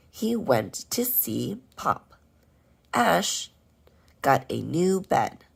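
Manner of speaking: put-on slow, lively poem reading for little kids; slow, expressive poem read-aloud for first graders; reading to children. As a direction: read each line slowly and clearly, punching the names Ash and Pop.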